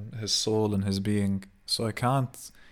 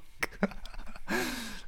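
laugh; 7 dB quieter than usual. Real, not acted. Laugh after mock shout.

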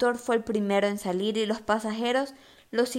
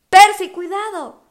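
high loud cry of warning; urgent warning; 10 semitones higher, 12 dB louder than usual. A narrator's reading. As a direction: shout a warning to a friend. Annabeth warns urgently.

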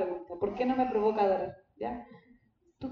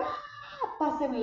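sudden high-pitched ask with a laugh; pleading softly on a very high note; 5 dB quieter, slower than usual. Retold or imitated. Asking again for the crying baby.

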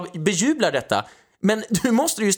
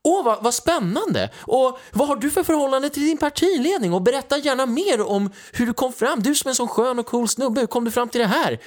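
higher-pitched fawning interviewer impression; sugary mock admiration, pitched up; mock gushing; 6 semitones higher than usual. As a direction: gush sarcastically, as if utterly fascinated. Imitating fawning interviewers of Bali.

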